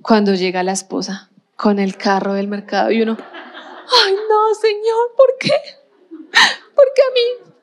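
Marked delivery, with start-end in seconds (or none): 3.2-7.5 much higher, laughing, half-crying "why me"; mock lament. Shopper laughingly wails to God as the line gets longer. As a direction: wail it as a comic complaint to God, laughing. Wife arrives with full cart.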